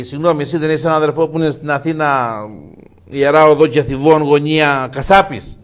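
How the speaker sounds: loud and slowing on the address; loud question. Acted. Brother asks the butcher's twin. (δυνατή ερώτηση, αργά στο τέλος)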